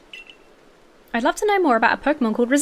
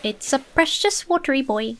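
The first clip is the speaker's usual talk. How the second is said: ordinary talk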